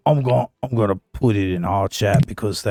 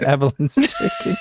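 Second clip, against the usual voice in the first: much higher character voice; high squeaky character voice; Evelyn character voice. Acted. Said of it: "I'm too much into character".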